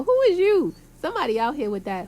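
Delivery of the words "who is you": sudden loud high challenge; loud who is you. Retold or imitated. Loud challenge to someone claiming to be family. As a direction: shout it at a stranger. Calling out strangers.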